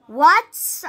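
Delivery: loud shout over the train noise; child shouting to the guard on the train; yelled. Acted. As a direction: yell it across to the moving train. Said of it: "I yelled out".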